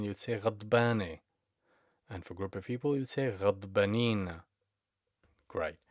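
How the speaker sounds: ordinary delivery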